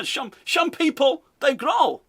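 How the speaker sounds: put-on high, drawn-out Dutch accent; mock Dutch accent. Host repeating manager's odd phrase, exaggerated Dutch accent. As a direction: overdo the fake Dutch, slushy S. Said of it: "That's what he's".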